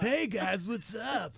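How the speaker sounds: mock surfer dude greeting; beach surfer. Host mocking a pastor fresh from the beach, surfer-dude voice. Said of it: "comes in the studio with no shirt on from the beach".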